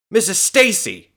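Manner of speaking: high, loud call; shout. A narrator's reading. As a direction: shout out her name in alarm. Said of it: "he shouted".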